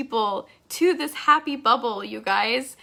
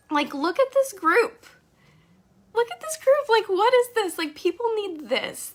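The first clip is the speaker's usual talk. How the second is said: excited; 9 semitones higher than usual. Real, not acted. Proud of her team.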